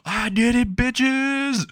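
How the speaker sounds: high, drawn-out victory cry; triumphant scream. Retold or imitated. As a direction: shout it out high, stretching the last word. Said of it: "starts screaming out".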